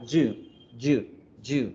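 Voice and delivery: yu with a firm Spanish Y, almost "ju"; Y as in yuca. Shown as a demonstration. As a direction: repeat yu as a separate demonstrated syllable. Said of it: "se pronuncia como la Y de yuca".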